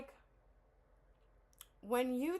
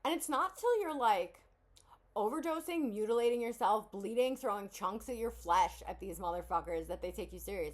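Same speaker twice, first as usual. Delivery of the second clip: usual speaking voice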